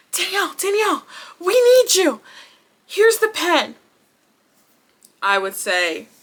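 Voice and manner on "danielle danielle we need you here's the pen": pleading bookers. Retold or imitated. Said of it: "they're like".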